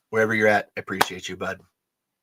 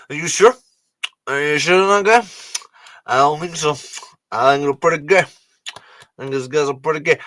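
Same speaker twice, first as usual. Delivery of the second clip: Jon Voight impression; 3 semitones higher than usual. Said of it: "I'm doing Jon Voight in Anaconda".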